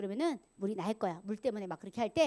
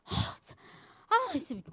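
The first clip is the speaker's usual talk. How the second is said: hammed-up high, loud exasperation; mock rage; 10 semitones higher, 10 dB louder than usual. Shown as a demonstration. Preacher demonstrates raging at the congregation, mock fury. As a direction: splutter in feigned anger between huffs. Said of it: "제가 여기서 막 분노를 내면서".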